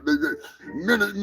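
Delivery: ordinary delivery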